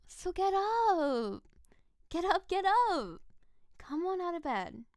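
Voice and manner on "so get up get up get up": eager urging. Acted. Urging him out of bed.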